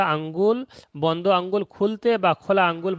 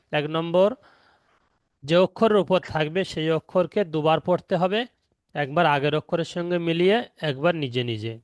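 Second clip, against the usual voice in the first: ordinary talk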